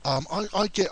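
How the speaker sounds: ordinary talk, nothing marked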